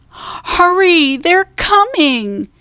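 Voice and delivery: high, stretched-out call; urgent warning. A narrator's reading. Annie warns Jack pirates are coming, urgent high call. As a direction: call out an alarm from a distance.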